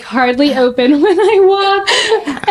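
real laughter breaking into the story; laughing while talking. Real, not acted. Model recalling her runway disaster, laughing.

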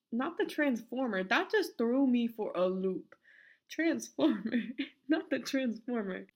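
can't believe it, laughing; laughing disbelief. Real, not acted. Cracking up to Caesar over the transformer.

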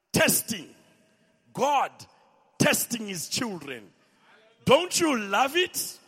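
ordinary delivery

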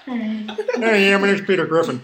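hammed-up cartoon dad voice; goofy cartoon dad, laughing through it; Peter Griffin impression. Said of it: "Like a Peter Griffin laugh".

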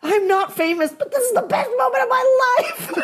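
very high, laughing through the words; overjoyed, laughing high; giddy laughing. Retold or imitated. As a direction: squeal it with delighted laughter. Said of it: "And I'm like".

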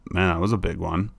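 soft, steady and unbothered; calm voice. Shown as a demonstration. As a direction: say it low-key so the dog stays calm. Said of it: "But I'm keeping my voice calm".

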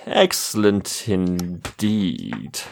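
slow, low archmage voice; villain voice. Acted. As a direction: drawl it like a smug wizard. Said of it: "what was his voice?".

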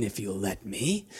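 lightly voiced sneaky lizard folk; sly thief voice.

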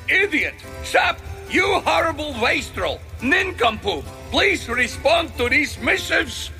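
shrill raging king dictating; mad king ranting. Acted. King dictating furious letter.

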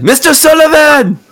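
eager sidekick knocking and calling for his boss; Alejandro calling out. Acted. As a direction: sing out his name from outside the door. Alejandro calls through the door.

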